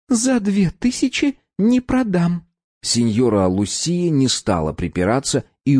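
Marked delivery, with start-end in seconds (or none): 0.1-2.4 put-on slow, high, firm young woman; firm refusal. A narrator's reading. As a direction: refuse firmly and slowly. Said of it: "внезапно обретя твёрдость".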